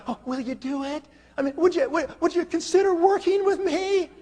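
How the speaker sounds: very high, meek begging voice; mock timid pleading. Shown as a demonstration. Recruiter parodying a needy pitch to a prospect, mock pleading. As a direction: plead in a put-on high little voice. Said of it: "I don't talk like that to people".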